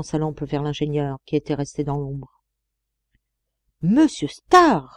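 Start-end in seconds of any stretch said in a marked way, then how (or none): 3.8-4.9 drawn-out, higher and louder exclamation; surprised echo. A narrator's reading. Friend suddenly learning who stands in the shadow, astonished. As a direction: echo the name slowly, astonished, falling at the end.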